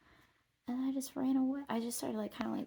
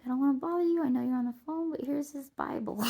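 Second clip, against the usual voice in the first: louder, higher mock-sweet lady offering a Bible; polite stranger; 5 semitones higher, 8 dB louder, faster than usual. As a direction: put on a sweet, eager church lady. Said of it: "this lady comes up in real life".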